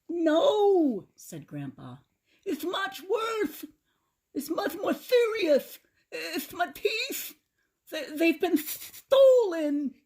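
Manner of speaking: put-on high, slow, lisping old man voice; toothless Grandpa. A narrator's reading. Grandpa mourns his stolen teeth, high toothless lisp. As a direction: lisp through a mouth with no teeth.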